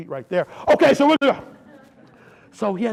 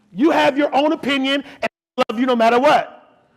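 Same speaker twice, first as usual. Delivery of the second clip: loud, high-pitched sermon delivery; emphatic preaching; 6 semitones higher, 8 dB louder than usual. Real, not acted. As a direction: drive the point home to the congregation at full voice. Preacher driving home his point.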